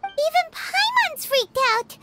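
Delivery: shaken and upset. Real, not acted. Paimon shaken by horror.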